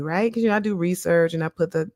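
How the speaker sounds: ordinary talk, nothing marked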